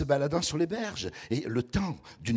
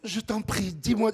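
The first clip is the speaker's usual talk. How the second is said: imitating the girl; 6 semitones higher than usual. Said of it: "puis après elle a fait ça".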